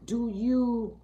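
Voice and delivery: usual speaking voice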